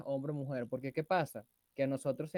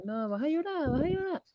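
mocking taunt; 12 semitones higher, 4 dB louder than usual. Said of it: "nos dicen".

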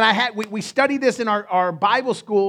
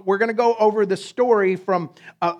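ordinary delivery

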